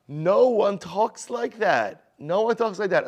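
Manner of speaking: no one talks like that, slow and loud, high pitched; emphatic. Real, not acted. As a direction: hammer home that no one talks like that. Teacher stresses the point emphatically.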